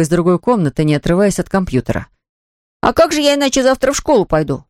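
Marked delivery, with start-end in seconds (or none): 2.8-4.6 put-on high-pitched child's protest; boy justifying himself. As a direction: plead your case to dad, pitched up. Мальчик оправдывается перед папой. (мальчик оправдывается высоким голосом)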